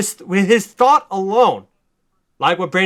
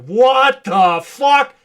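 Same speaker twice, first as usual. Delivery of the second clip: high, loud, drawn-out disbelief; slow, loud, high shocked exclamation; stunned; 9 semitones higher, 6 dB louder, much slower than usual. Retold or imitated. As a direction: gawk at the whales, slowly. Shocked goons at whales.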